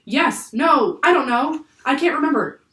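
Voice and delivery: high, loud, broken-up answers; flustered panic. A narrator's reading. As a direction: blurt each uncertain answer. Jack flustered, searching memory urgently.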